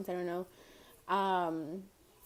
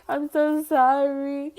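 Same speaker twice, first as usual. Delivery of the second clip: slow, louder mock apology, near tears; near-tearful apology; 5 semitones higher, 8 dB louder, slower than usual. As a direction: drag it out as if about to cry. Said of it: "but she wanted to cry".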